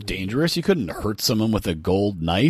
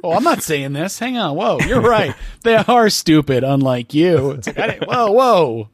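higher, louder gleeful gloating; mock smug customer; 7 semitones higher, 5 dB louder than usual. Clear member passing the waiting crowd, hammed-up gloating. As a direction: gloat at the people in line. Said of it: "mock line walker".

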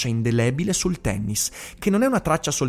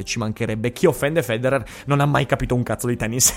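normal talking voice

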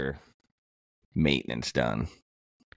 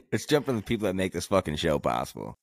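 normal talking voice

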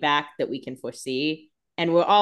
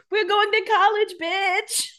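much higher, squealing mock Caroline; slower than usual. Cohost voices Caroline cheering about college, squealing high.

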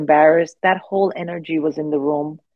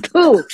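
a high, loud spitting "Pooh" through a laugh; laughing imitation of spitting; mock spit; 10 semitones higher, 7 dB louder than usual. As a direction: puff out the spit sound, laughing. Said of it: "She went".

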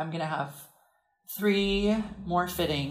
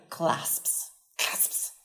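hushed, drawn-out whisper; 6 dB quieter than usual. Real, not acted. Explaining to co-host what is needed for crafting, whispered. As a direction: whisper the word with no voice.

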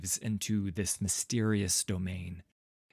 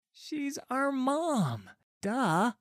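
put-on high, drawn-out kid voice; child stating the obvious; slower than usual. A narrator's reading. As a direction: say it like an exasperated kid. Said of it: "a child says".

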